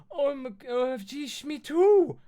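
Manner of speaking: very high, loud excited shriek; piercing, thrilled squeal. Acted. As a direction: shriek it like an overexcited fan. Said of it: "I shrieked".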